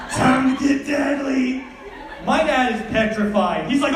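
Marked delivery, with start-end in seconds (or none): 0.1-1.6 slow, higher tough-guy line; action hero one-liner. Pretend. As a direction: growl it like a movie badass. Kid playing action hero.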